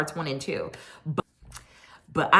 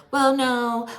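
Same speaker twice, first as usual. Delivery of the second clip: high mocking denial; a touch of mimicry, high; mock Mia; 9 semitones higher than usual. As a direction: imitate her defensive no. Mia denying, voiced by host.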